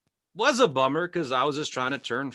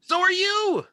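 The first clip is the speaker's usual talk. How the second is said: exasperated; 10 semitones higher, 5 dB louder, much slower than usual. Acted. Comic's exasperated reply to chat.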